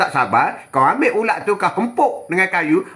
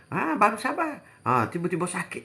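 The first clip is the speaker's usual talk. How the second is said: ordinary delivery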